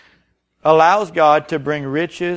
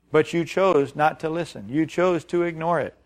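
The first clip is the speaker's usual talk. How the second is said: ordinary talk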